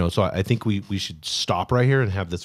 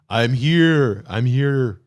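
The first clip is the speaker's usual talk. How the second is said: booming God voice; 7 dB louder, much slower than usual. Acted. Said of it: "big booming".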